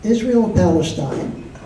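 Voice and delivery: ordinary delivery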